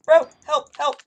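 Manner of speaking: loud mock panic cry; loud retold panicked call to a bro; panicked cry for help. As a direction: yell for help like you're freaking out. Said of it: "I was freaking out".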